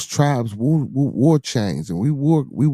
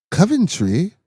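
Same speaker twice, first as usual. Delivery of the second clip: higher-pitched put-on English accent; 8 semitones higher, slower than usual. Shown as a demonstration. Trying out an English accent for the chat, higher and rising. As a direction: try an English accent with a questioning lift. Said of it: "that's my English accent".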